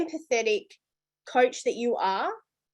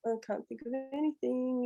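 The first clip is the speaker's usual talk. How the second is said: softer, put-on weary voice; mock dead-tired; 8 dB quieter than usual. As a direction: play too tired to think, half under your voice. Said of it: "even if I'm dead tired".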